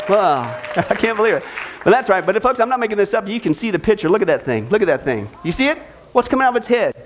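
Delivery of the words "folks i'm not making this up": ordinary talk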